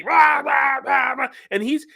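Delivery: high, loud "rah rah rah" babble; high-pitched mock hollering; mock loud yammering. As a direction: imitate a roomful of noisy shouting. Imitating noisy roommates' constant chatter.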